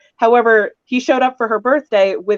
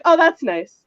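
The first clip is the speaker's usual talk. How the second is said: feigned nonchalance, a bit higher; cool in character; 7 semitones higher, slower than usual. Acted. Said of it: "but my character was like".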